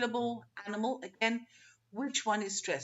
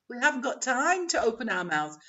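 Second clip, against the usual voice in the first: ordinary talk, nothing marked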